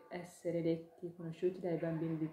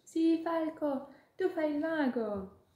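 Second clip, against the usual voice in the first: high, loud, slow little voice; a tiny creature insisting with glee; insistent ladybug; 11 semitones higher, 8 dB louder, slower than usual. Acted. Ladybug telling the hawk he is a wizard, high and insistent.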